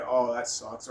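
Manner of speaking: ordinary talk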